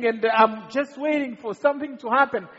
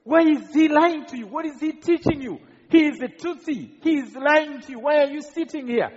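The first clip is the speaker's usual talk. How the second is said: raised, higher accusing voice; retold shouting; 6 semitones higher than usual. Killer demands answers from the teacher's friends, retold shouting. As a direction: imitate an angry attacker shouting questions. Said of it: "he keeps shouting".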